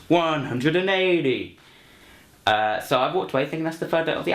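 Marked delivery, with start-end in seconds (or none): 0.1-1.6 high, stretched-out darts call; hammed-up high yell, drawn right out; mock darts scream. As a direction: call it out slowly like a darts announcer. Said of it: "And he screams".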